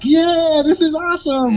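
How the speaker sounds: high, loud gleeful cheer; ecstatic. Retold or imitated. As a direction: gush like opening a gift.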